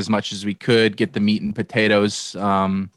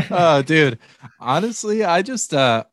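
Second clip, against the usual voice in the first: laughing; 9 semitones higher, 3 dB louder than usual. Real, not acted. Laughing at the question about his excuse.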